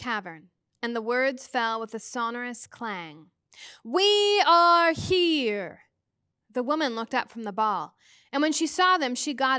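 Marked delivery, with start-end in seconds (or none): 3.8-5.8 slow, high, loud echoing call; clear, strong ringing voice. A narrator's reading. Mrs Which announcing their arrival to the medium, ringing and sonorous. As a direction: let each word ring out like a clang in a cavern. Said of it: "voice rang out clear and strong".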